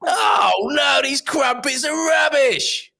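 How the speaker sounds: loud, high, drawn-out London bloke; Ray Winston. Acted. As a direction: shout it like an exaggerated street bloke. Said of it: "Ray Winston, you regular sort of bloke on the street".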